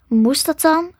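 plain reading voice

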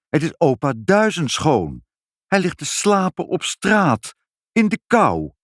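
high, exclaiming father; calling out. A narrator's reading. Father spots the old man outside and cries out, high-pitched. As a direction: exclaim it with rising alarm. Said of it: "riep vader".

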